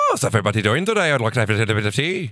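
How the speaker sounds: hammed-up stage Irish lilt; broad, clichéd Irish accent impression. Shown as a demonstration. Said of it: "Stereotypical would be".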